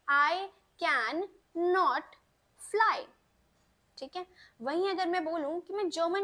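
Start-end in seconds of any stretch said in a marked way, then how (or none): 0.1-3.0 slow model sentence. Shown as a demonstration. Teacher building the example sentence very slowly. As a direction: drag it out word by word. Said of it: "तो कैसे बनाएंगे sentence को?".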